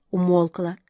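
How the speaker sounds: ordinary delivery, nothing marked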